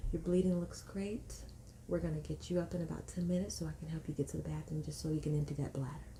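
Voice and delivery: soft, hushed bedside voice; reassuring midwife. Retold or imitated. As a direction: imitate tender, unhurried reassurance. Midwife reassuring her after exam.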